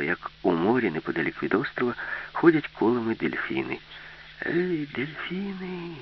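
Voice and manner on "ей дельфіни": high, drawn-out distant call; girl calling out. A narrator's reading. Alisa hails the dolphins from the island, high drawn-out call. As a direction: call slowly to far-off dolphins, pitched high without much volume. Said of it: "крикнула Аліса".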